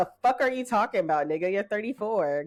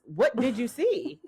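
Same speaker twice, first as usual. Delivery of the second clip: incredulous; 3 semitones higher, slower than usual. Real, not acted. Repeating question mockingly.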